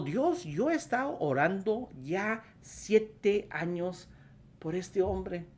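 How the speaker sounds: high, drawn-out plea to God; pleading prayer. Retold or imitated. Wife crying out to God after years of praying, retold pleading.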